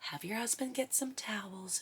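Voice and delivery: soft, hushed calm instruction; calm midwife. Retold or imitated. Midwife calmly telling the laboring mother to get towels, whispered. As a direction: reassure her quietly, no panic. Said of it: "She was very calm".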